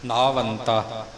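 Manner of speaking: ਨਾਵੰਤਾ slow and low, with weight on the ਨ; stress on the ਨ. Shown as a demonstration. Teacher repeats the word for the student, stressing the ਨ. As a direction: press the opening ਨ of ਨਾਵੰਤਾ. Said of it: "ਨੰਨੇ ਦੇ ਉੱਪਰ ਭਾਰ ਦੇਣਾ ਹੈ".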